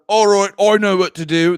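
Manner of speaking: loud, hammed-up British accent; broad mock-English author voice; J K Rowling. Author decides how to tell Harry's story, broad British accent. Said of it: "This is what J K Rowling sounds like".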